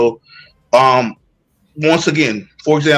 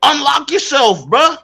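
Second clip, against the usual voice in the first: higher, lightly voiced [name] line; quoting [name]; 8 semitones higher than usual. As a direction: toss out the [name] quote, pitched up. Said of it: "I'ma tell you like [name] said".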